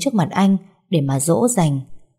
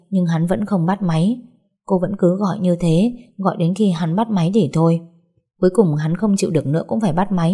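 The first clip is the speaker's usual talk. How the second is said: usual speaking voice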